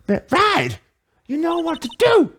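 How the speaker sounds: high excited partner. Acted. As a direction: voice up high, eager and urgent. Voicing the partner.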